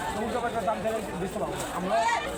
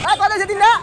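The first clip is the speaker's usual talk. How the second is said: calling out; 10 semitones higher, 13 dB louder, much faster than usual. Real, not acted. Calling out across cattle market.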